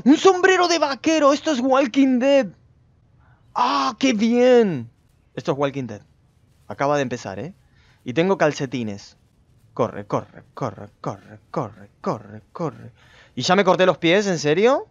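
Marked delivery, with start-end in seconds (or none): 0.0-4.9 high, thrilled exclamation; excited delight. Real, not acted. Gamer finding a cowboy hat, thrilled outburst. 9.7-12.9 low, soft repeated command; hushed urging. Real, not acted. Player urging the character to run, low and hushed.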